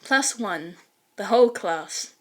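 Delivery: ordinary delivery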